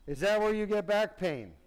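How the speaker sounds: loud clinical question. Real, not acted. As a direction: call it out to a groggy patient. Asks sedated patient about pain.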